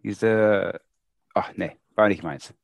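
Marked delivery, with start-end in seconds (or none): none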